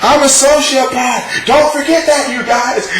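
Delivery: high, hammy genius boast; mock Dan boast. Mocking Dan bragging to everyone, high and hammy. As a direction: preen like a smug madman. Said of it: "fucking Dan over here".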